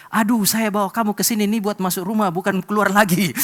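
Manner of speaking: a frustrated uncle throwing up his hands at the nephew; exasperated uncle. Retold or imitated.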